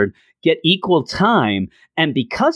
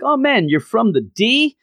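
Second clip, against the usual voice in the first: high, amused disbelief; mock surprise; 10 semitones higher than usual. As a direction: tease her like you can't believe it. [name] teasing Starling's Detroit line.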